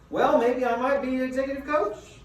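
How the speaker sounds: imitating a voice rising into a question; each phrase lifts upward at the end like a question; uptalk. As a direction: say it unsure, as if asking. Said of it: "they go up at the end of, like, a question".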